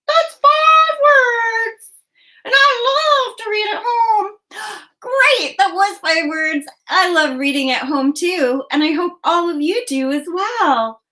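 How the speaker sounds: high excited puppet bird, singsong; Ollie puppet voice. Acted. Puppet bird cheering the kids on, high and excited. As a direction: chirp the praise like a thrilled puppet.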